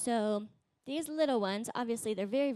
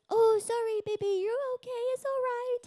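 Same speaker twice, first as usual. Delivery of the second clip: much higher and louder, soothing singsong; fussing parent; 12 semitones higher, 6 dB louder than usual. Retold or imitated. Parent soothing an unhurt baby, high and loud coo. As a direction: overdo the comfort like a worried parent. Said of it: "When the parent goes".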